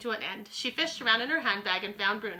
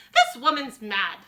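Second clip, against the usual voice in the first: indignant scorn; 2 semitones higher, 9 dB louder, slower than usual. Acted. Missus Jenkins dismisses grandmother.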